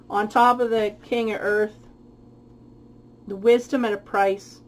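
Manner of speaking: ordinary talk, nothing marked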